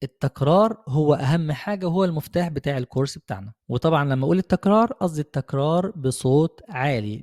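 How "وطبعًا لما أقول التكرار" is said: ordinary talk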